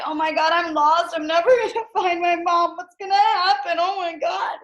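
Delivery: loud, high, hammed-up panic with a nervous laugh; scared panic. Teacher acting a child lost at the mall, mock loud panic. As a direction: play a panicking lost kid. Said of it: "that you are scared".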